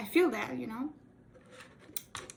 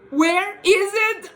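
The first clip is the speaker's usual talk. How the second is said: high, loud panicked cry, slow; 9 semitones higher, 17 dB louder, slower than usual. Retold or imitated. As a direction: replay the panic of a lost lens. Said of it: "I was panicking".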